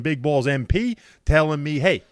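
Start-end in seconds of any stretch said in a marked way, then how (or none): 1.8-2.0 loud, high mock gloat; gloating taunt. Host reading out friends' texts, loud mocking gloat. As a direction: rub it in like a smug friend.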